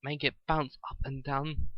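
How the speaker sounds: usual speaking voice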